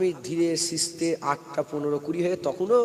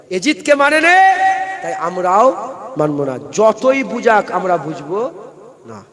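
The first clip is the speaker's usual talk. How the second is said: loud, high defiant proclamation; fiery preaching; 3 semitones higher, 7 dB louder, slower than usual. Real, not acted. Preacher rousing the crowd against Yazid, loud. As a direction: declare it to the crowd with full force.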